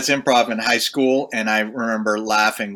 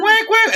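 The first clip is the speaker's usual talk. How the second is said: high, loud whack imitating a string bend; guitar bend sound; 4 dB louder than usual. Guitarist imitating his unison bends in a jazz solo, high loud whacks. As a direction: sing out the bent note as whack. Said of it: "I'm just going".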